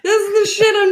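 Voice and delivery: high, loud, laughing dismissal; laughing exasperation. Real, not acted. Laughing exasperation at the bad accent.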